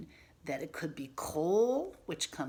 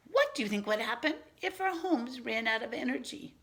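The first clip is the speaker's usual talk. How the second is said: put-on child's question; 5 semitones higher, 4 dB louder, faster than usual. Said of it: "Then he asked me".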